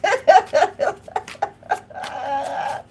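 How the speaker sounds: high, loud cry-laugh; loud laughing fit. Real, not acted. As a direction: burst into helpless laughter. Cracking up at own impression.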